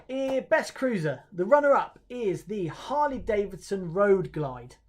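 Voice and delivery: slow, loud, high announcement; awards announcer. Real, not acted. Host reveals the runner-up to viewers, announcer delivery. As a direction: draw out each name like a host.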